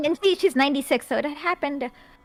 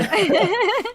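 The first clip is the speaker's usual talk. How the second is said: sudden high burst of laughter; amused laugh; 12 semitones higher, 6 dB louder than usual. Real, not acted. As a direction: laugh out loud, caught off guard. Teasing over copied article.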